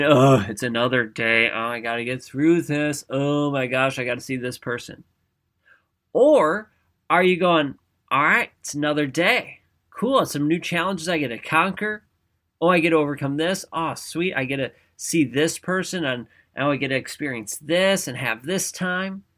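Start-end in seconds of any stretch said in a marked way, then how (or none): 0.0-5.0 weary, lower-pitched groan of a complaint; mock negative dread. Negative thinker waking up to another day, mock weary dread. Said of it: "Are you focused on the negative all the time?". 8.1-19.2 mildly upbeat, eager self-talk; acted positive outlook. Positive thinker waking up to new challenges, mildly upbeat eagerness. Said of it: "almost fake it until you make it".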